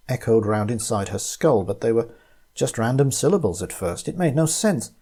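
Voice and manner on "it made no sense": ordinary delivery, nothing marked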